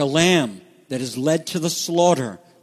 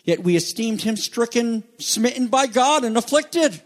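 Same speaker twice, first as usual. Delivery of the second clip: high, ringing scripture read; fervent reading; 7 semitones higher than usual. Acted. Preacher proclaiming the verse to the congregation, fervent. Preacher reads Isaiah with passion.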